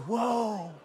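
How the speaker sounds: soft, drawn-out whoa; sheepish amazement. Retold or imitated. Driver hearing his speed from the officer, softly stunned. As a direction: let it out quietly, humbled. Learning how fast he went.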